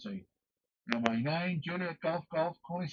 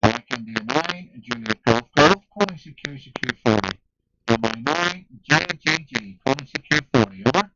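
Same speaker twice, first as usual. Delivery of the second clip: calling out loudly over the air; loud radio call; 12 dB louder than usual. Real, not acted. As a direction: project the call hard.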